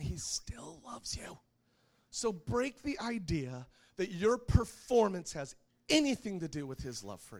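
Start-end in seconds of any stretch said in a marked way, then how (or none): 0.0-1.4 slow, emphatic half-whisper; hushed reassurance. Real, not acted. Preacher assuring congregation of God's love, slow hushed whisper. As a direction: let it sink in softly, word by word.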